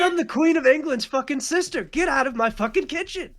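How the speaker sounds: high, furious chef retort; high-pitched outraged chef yell; yelling British chef. Acted. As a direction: shout them down as a British chef. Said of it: "some old fucking British chef is yelling".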